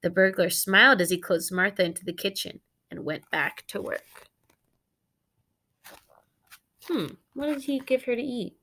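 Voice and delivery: lively read-aloud for the class; story narration. A narrator's reading. Teacher reading a picture book to her students, animated storytelling.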